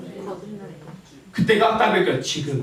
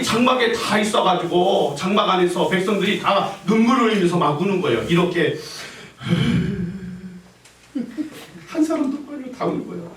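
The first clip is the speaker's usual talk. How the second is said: ordinary talk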